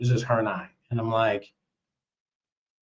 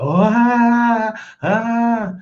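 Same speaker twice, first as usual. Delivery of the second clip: high imitated guffaw; hammed-up mocking laugh; mock laughter; 9 semitones higher, 5 dB louder, much slower than usual. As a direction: ape his laughing. Mimicking security guy laughing.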